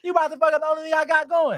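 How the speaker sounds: high, loud, fast mock outrage; imitated angry attitude. Vendor accusing a customer of ruining his business, imitated indignation. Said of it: "the attitude he had".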